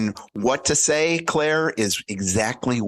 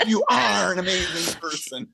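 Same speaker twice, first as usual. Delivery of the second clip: higher-pitched imitation of a woman's compliment; Claire; 7 semitones higher than usual. Imagining the host as his ringtone, high-pitched imitation. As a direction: mimic her praise up high. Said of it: "Claire saying".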